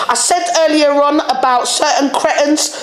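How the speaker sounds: normal talking voice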